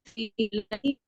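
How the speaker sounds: normal talking voice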